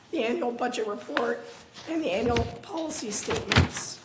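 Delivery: ordinary delivery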